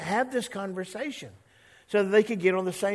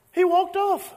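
much higher and louder, huffy; sulky kid storming off; 13 semitones higher, 7 dB louder than usual. Acted. Preacher acting out Jonah storming off in a huff. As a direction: act out a child stomping away. Repeats line, then acts it out.

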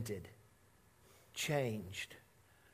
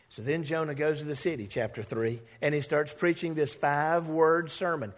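ordinary talk, nothing marked

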